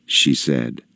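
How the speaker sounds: ordinary delivery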